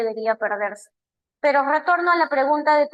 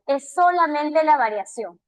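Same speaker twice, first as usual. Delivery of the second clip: ordinary talk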